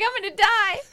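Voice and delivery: very high, loud retold panic; frantic. Singer reliving being sucked out to sea, frantic. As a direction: cry it out in dramatised panic. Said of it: "I was frantically trying to get".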